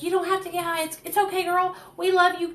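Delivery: calm her down, mock sweet; lightly voiced reassuring friend; calming Trevor. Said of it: "trying to calm me down".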